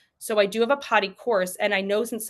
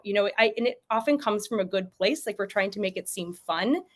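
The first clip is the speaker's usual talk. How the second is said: normal talking voice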